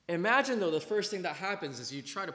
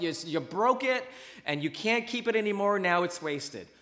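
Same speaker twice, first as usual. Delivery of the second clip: ordinary delivery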